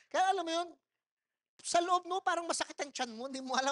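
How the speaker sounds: usual speaking voice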